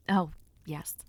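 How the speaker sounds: breathy whispered aside; hushed confiding whisper. Acted. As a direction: lean in and whisper it. Soft aside to the listener.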